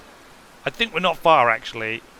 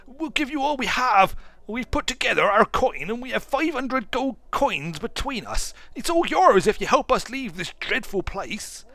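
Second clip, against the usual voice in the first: much higher, pleading townsman's voice; desperate haggard man; 8 semitones higher than usual. A narrator's reading. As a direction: beg the lord to save the people. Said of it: "shouting above the noise of the crowd".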